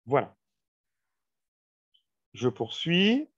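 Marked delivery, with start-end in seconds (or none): none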